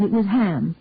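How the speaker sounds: ordinary delivery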